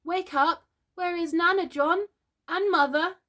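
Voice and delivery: put-on high, plaintive child's cry; loud, high-pitched childish pleading; Michael. A narrator's reading. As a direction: cry out like a small lost boy. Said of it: "Michael would cry".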